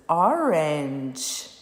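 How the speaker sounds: orange drawn out as o-range, two beats; "orange" said slowly to show its two syllables. Shown as a demonstration. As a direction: stretch both syllables of orange, no vowel at the end. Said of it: "Ó, abaixou duas vezes".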